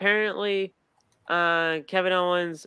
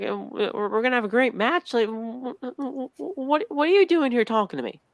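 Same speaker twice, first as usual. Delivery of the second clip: stammering confusion; 6 semitones higher than usual. Retold or imitated. Priest voiced, confused stammer.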